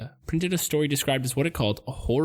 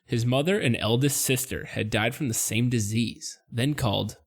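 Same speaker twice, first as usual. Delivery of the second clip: ordinary talk, nothing marked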